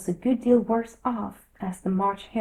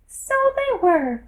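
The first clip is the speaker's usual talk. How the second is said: high, small dormouse voice; a tiny drowsy creature telling a tale; sleepy little dormouse; 7 dB louder than usual. A narrator's reading. Dormouse voice held.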